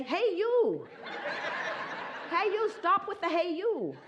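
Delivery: high mock yell with laughter; yelling back, laughing. Esther yelling back at the policeman, laughing mock yell. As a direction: shout back at him, half amused. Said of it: "Esther wanted to say".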